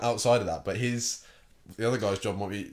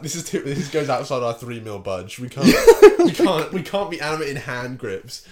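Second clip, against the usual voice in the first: laughing through quote; 9 dB louder than usual. Retold or imitated. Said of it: "it's like".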